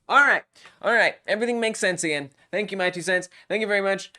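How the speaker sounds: loud, high cheering relief; real celebration after a fix; excited. Real, not acted. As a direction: whoop it up, fast.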